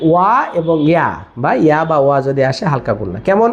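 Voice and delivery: usual speaking voice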